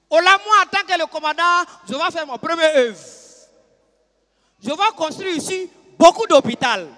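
loud official speech to the troops; commander's address. Acted. New commander addressing the camp on his first works, loud speech. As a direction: proclaim it like a self-important commander. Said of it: "il vient maintenant s'adresse à nous".

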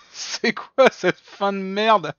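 slow, high, loud outrage; outraged shout. Real, not acted. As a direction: yell at the bad ending. Outraged at game ending.

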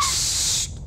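sharp high whispered shush; hush. Acted. Stowaway hushing the girl in the hold, high whispered shush.

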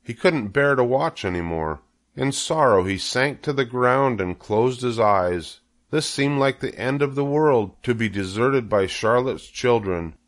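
plain reading voice

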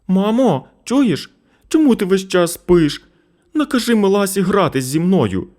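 put-on high, loud nagging child; boy pestering his sleeping sick mother; insistent boy. A narrator's reading. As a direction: nag like an unstoppable toddler. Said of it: "не вгамовувався Сашко".

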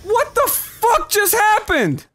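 high, slow, stretched-out outcry; drawn-out, high-pitched astonishment; shocked disbelief. Real, not acted. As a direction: wail it in bewildered shock. Stunned by game event.

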